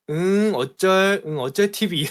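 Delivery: loud, drawn-out mock jeer; mocking taunt. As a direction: imitate a childish taunt, stretching the words loudly. Retold chatter's taunt before leaving. (약 올리듯 크게 늘여 흉내 내기)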